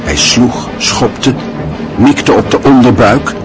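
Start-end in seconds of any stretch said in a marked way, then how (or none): none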